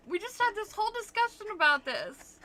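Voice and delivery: high, loud, laughing protest; laughing exasperation. Real, not acted. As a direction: squeal it out through laughter. Cracking up over accent confusion.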